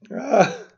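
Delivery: sudden loud, high "ah" of frustration; frustrated yelp. Real, not acted. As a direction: let out an exasperated cry. Frustrated with the spreadsheet.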